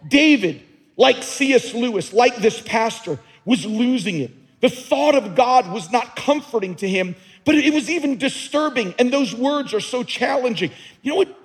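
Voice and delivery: rising pulpit fervour, voice pushed up; full-force preaching. Acted. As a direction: preach it hard, climbing.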